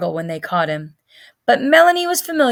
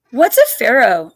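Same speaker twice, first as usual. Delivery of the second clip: suspicious; 3 semitones higher, 6 dB louder than usual. Acted. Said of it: "Marshall asked suspiciously".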